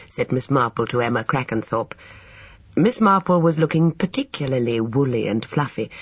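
plain reading voice

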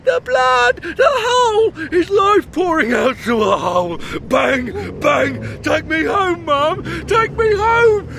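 high, loud crying for his mum; a child screaming over his dead father; hysterical sobbing shout. Retold or imitated. Reliving his dad's death.

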